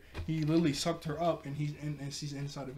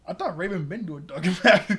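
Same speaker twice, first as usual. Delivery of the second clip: laughing; 4 semitones higher, 7 dB louder than usual. Real, not acted. Laughing at the plot.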